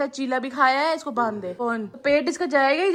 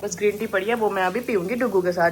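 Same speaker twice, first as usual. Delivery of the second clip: ordinary talk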